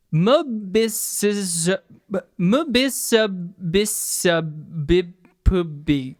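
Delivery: mubisabisabibpubi spelled out very slowly; Ubby Dubby speak. Shown as a demonstration. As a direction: drag out mubisabisabibpubi syllable by syllable. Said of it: "Mississippi would be".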